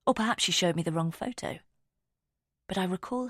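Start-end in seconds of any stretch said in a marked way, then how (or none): none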